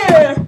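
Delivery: loud, strained "ugh" as if shoved; sudden loud cry of being squashed; pained grunt. Pretend. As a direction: grunt like you're being knocked over. Jostled on the tube.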